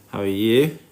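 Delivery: usual speaking voice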